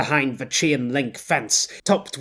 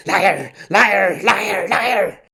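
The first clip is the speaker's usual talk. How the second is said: liar liar rising higher and louder, obsessive; building angry muttering; 6 semitones higher, 5 dB louder, much slower than usual. Acted. Quiddell fixating on Billy the liar, muttering that builds in anger. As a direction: let the repeated liar boil over. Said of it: "his accidental muttering".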